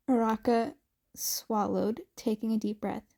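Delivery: plain reading voice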